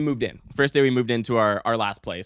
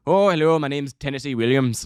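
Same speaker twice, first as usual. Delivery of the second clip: mock Irish accent; 2 semitones higher, 4 dB louder than usual. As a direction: put on a comic Irish brogue. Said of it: "is not an Irish writer".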